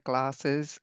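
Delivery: ordinary talk, nothing marked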